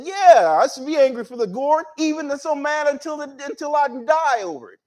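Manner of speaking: high, indignant retort as Jonah; acted crazy mad. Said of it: "he's on a crazy mad".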